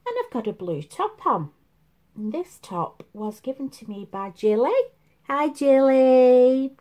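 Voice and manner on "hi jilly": loud, drawn-out happy hello; cheery greeting. Real, not acted. To friend Jilly watching, loud cheery hello. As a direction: call out a big hello.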